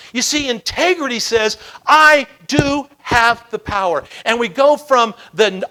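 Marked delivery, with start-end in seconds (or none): 1.9-4.0 slow and loud, each word of "I do have the power" punched; emphatic conviction. Acted. Integrity answers the whiners, emphatic "I do have the power". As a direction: declare "I do have the power" word by word, higher and louder.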